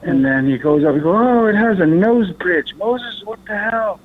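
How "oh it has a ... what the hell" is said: high, drawn-out alarmed discovery; freaking out. Retold or imitated. Brother feeling out a face underneath, imitated freak-out. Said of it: "he's freaking out".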